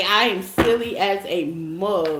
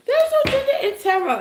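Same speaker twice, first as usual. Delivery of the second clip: sassy; faster than usual. Real, not acted. Repeats sassy declaration.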